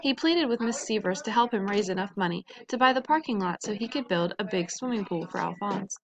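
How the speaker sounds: plain reading voice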